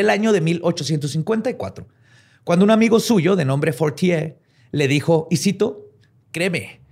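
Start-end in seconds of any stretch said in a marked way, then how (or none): 6.3-6.8 bad French accent, slow; cartoonish French inspector voice. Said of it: "Tenía un pésimo acento francés".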